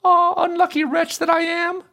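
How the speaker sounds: very high princess voice, regretful; sighing lament. A narrator's reading. Princess regretting the match she refused, sighing. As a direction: bewail your luck in a high-pitched voice. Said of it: "sighed she".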